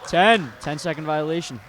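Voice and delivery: high, loud shout of the call; excited call. Real, not acted. Calling a violation.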